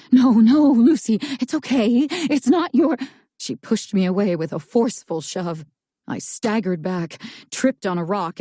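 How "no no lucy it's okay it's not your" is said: blathering. Acted.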